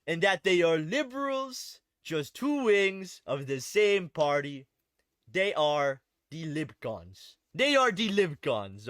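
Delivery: hammed-up French-Canadian accent, slowed down; Maxime Bernier accent. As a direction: imitate a French-Canadian politician's accent, drawing out the phrases. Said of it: "I gotta say it in his accent".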